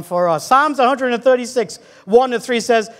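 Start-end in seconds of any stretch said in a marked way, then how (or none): none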